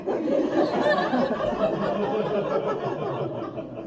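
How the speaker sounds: very high squeal of laughter. Real, not acted. Comic squealing with laughter at the question. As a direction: shriek with laughter.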